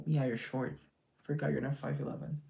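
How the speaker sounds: usual speaking voice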